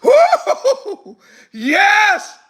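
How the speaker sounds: high, loud whoop of delight; whooping joy. Real, not acted. Preacher rejoicing to the congregation, exultant whoop. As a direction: whoop it out, triumphant. Preacher celebrating Babylon's fall.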